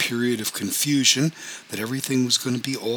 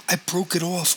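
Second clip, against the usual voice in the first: higher-pitched, understated cry of protest; 7 semitones higher than usual. A narrator's reading. Cousin defends herself to the betrayed wife, mildly crying out. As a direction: plead it in a raised pitch, mildly distressed. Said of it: "cried Robin".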